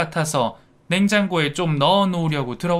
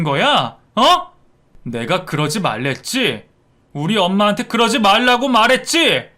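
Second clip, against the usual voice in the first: angry dressing-down, building to louder outbursts; furious son; 3 semitones higher, 4 dB louder than usual. Acted. Son rebuking his wife for insulting his mother, furious. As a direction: tear into her, hammering each question. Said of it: "화가 머리끝까지 났는지".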